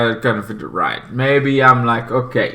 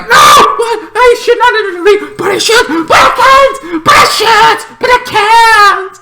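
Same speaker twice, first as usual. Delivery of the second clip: very high, loud, squeaky panic; cartoonish high-pitched dithering; Brainy panicking; 6 dB louder than usual. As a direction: torn and flailing, pitched way up. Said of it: "Brainy's like".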